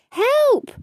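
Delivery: very high cry for help; a cat calling out from the water. Acted. Klutz the cat falls in the pond, high desperate cry. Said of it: "Klutz the cat cried".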